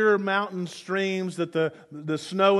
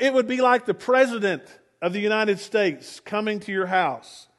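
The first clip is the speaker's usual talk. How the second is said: normal talking voice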